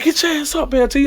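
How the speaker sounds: high, held-back coach's order; imitated coach. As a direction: mimic the coach ordering a player up. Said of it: "that's how he was".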